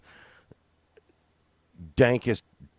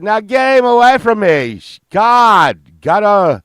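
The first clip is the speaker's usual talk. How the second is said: much higher, much louder frantic rock-star yell; 11 semitones higher, 15 dB louder, slower than usual. Retold or imitated. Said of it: "He's like".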